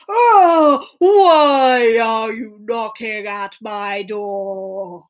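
slow, drawn-out storybook ogre; grumpy ogre answering an intruder; scary ogre. Acted. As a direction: boom out the opening, then drag the words menacingly. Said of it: "pulled a scary face at Puss. He went".

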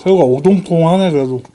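talking while chewing food; muffled words around food; mouth full, chewing. Real, not acted. Seen 0: middle speaker bites shrimp at -1, cheeks full chewing until ~2.9.

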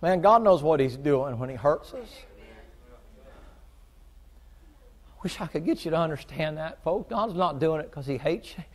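loud, high preaching, voice climbing; preaching at full force. Acted. Preacher to the congregation about God's hurts, building to a loud fervent climax. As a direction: pour it on the congregation like a revival preacher.

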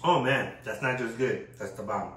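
usual speaking voice

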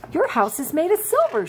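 bunny voice. Acted. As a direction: gasp it out in wonder. Stumpy the bunny marvels.

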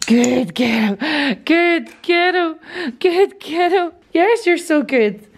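high-pitched gushing praise to a dog; excited puppy praise. Real, not acted. Owner rewarding puppy in clicker training, high excited praise.

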